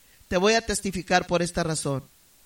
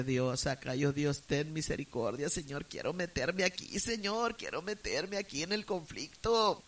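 soft, pleading inner prayer; hushed prayer; 4 semitones lower, 7 dB quieter than usual. Retold or imitated. Frightened daughter praying silently during the fight, hushed. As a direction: pray under your breath, begging God.

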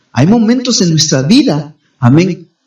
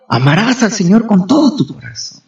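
ordinary talk

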